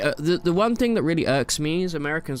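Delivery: ordinary delivery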